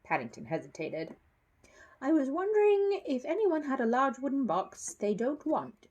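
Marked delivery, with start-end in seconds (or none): 2.0-5.9 hopeful. Acted. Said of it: "he said hopefully".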